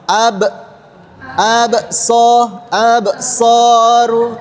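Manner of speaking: slow, drawn-out syllables ab, so, soru; Quran recitation demo. Shown as a demonstration. Teacher models recitation for the students, slow and drawn out. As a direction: sound out "ab, soru" syllable by syllable without a glottal stop. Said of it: "Bagian akhirnya jangan sampai ada hamzah".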